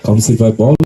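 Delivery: loud rapid play-by-play; frantic football commentary. Real, not acted. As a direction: call the play as it happens. Live play-by-play. (উত্তেজিত football ধারাভাষ্য)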